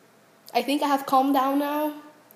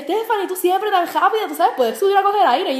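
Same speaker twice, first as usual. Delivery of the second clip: a lightly voiced coach, higher; coach's voice; 6 semitones higher than usual. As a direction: imitate the coach cheering her on. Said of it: "mi coach siempre me decía".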